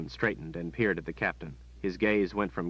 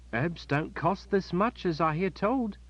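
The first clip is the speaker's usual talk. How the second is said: put-on high, slow child's voice, amazed; a boy staring at a silver coin; astonished boy; 10 semitones higher, slower than usual. Boy amazed at the captain's coin, astonished.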